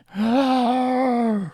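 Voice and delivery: long satisfied sigh from the villain. Acted. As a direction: breathe out in slow relief. Lich sighs in relief.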